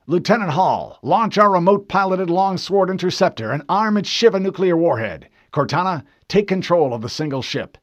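loud, firm battle orders; commanding captain. Acted. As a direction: issue orders to the bridge crew.

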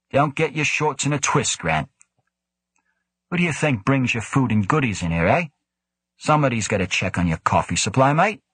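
lightly voiced cocky joker; cheeky Devlin teasing. A narrator's reading. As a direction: needle him with a grin you can hear.

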